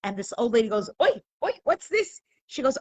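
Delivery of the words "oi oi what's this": put-on high-pitched old lady's exclamation; mock-alarmed kvetch; acted dismay. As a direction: cry out in shocked concern, pitched well up. Old lady exclaims at limping boy.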